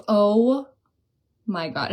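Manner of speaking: ordinary delivery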